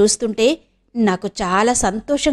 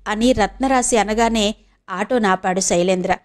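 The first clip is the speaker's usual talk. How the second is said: plain reading voice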